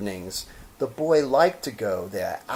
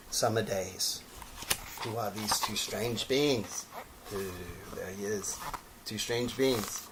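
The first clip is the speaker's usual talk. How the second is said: hushed aside; 10 dB quieter than usual. Acted. Reader pausing to muse softly over the strange beings. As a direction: lean in and wonder quietly.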